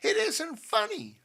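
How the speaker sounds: high, loud, slow retort; indignant snap. A narrator's reading. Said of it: "snapped Missus Dobson".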